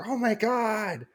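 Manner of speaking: put-on in-laws hearing the baby news, slowly amazed. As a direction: stretch it out in delighted disbelief. In-laws hear baby announcement.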